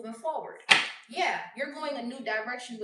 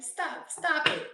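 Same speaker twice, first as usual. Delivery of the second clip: scolding; 5 semitones higher, 4 dB louder, slower than usual. Real, not acted. Scolding the cheater.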